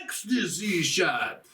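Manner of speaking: drawn-out mock announcer shout; game announcer. Game announces the special shot, mock-heroic call. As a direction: call it out slowly like a game sound. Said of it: "and then it goes".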